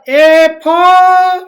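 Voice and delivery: put-on high, loud child's chant; imitating a schoolkid's lead line; child reciting. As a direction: call it out like the kid leading the row. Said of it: "एक बोलता था".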